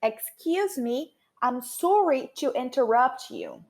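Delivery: slow, carefully spaced English apology; teacher's drawn-out demonstration; model phrase. Shown as a demonstration. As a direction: say it slowly as an example for learners.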